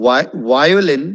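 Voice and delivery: violin as strong "VY-o-lin"; first-syllable stress. Shown as a demonstration. Teacher giving the first syllable importance, stressed violin. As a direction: lean on the Vi- of violin. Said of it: "first സിലബിളിന് importance".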